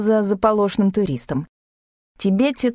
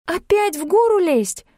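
much higher, aghast; gasping dismay; 10 semitones higher than usual. A narrator's reading. Tanya aghast at climbing again, gasping dismay. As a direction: gasp at the idea. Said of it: "ахнула девушка".

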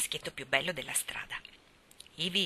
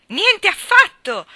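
put-on high, slow, loud boy's yell; furious shout; 13 semitones higher, 14 dB louder, slower than usual. A narrator's reading. Gerald rejects being Ivy's fiancé, furious shout. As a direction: refuse at the top of your voice. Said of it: "gridò Gerald furibondo".

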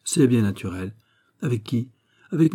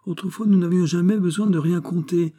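higher-pitched, animated Porthos; Porthos, grumbling about new ways; 8 semitones higher than usual. Acted. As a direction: grumble as Porthos missing the old days. (Porthos contrarié, regrettant le passé)

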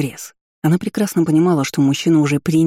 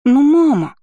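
put-on high, loud whining little girl; whiny child; 10 semitones higher, 5 dB louder, slower than usual. A narrator's reading. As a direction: whine like a cross little girl. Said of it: "недовольное личико".

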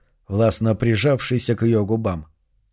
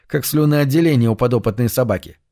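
plain reading voice

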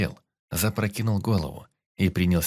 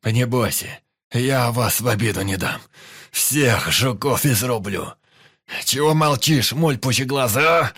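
loud, high manic shouting; frenzied yelling; 4 semitones higher, 6 dB louder than usual. A narrator's reading. Pyotr bellowing at the silent Indian, manic. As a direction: yell it in a wild battle frenzy. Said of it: "Продолжал орать Пётр".

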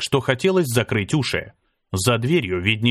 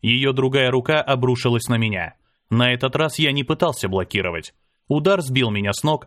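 plain reading voice

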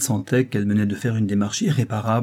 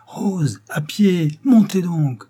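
high, louder cry of surprise; surprised cry; 8 semitones higher, 4 dB louder, much slower than usual. A narrator's reading. Driver hails Rose on foot from his carriage, surprised cry. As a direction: call out in astonishment. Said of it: "Un cri de surprise".

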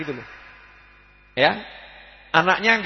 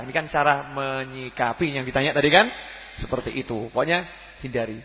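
usual speaking voice

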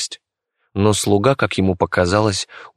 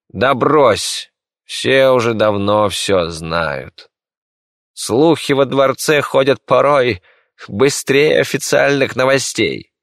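high, lightly scoffing ruler; dismissive emperor; 5 semitones higher, 3 dB louder, slower than usual. Acted. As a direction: brush aside his fears. Император отмахивается от секретаря. (пренебрежительный император)

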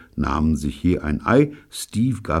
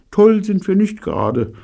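Evelyn's voice; 6 dB louder than usual. Acted. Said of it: "sagte Evelyn".

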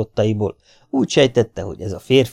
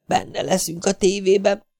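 put-on happy child's whisper; 11 semitones higher than usual. A narrator's reading. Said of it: "súgta boldogan Domi".

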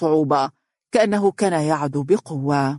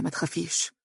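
"ما تخافيش" repeated much softer, almost breathed; hushed reassurance; 10 dB quieter than usual. A narrator's reading. Husband soothing wife, repeating "ما تخافيش" hushed. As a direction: sink "ما تخافيش" to a near whisper. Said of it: "ربت على كتفها مطمئنا".

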